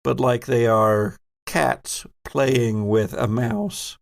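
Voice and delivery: ordinary talk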